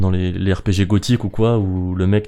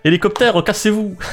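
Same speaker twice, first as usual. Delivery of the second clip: high-pitched sci-fi robot; silly robot command; 12 semitones higher than usual. Pretend. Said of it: "tu peux bien faire un accent de S F".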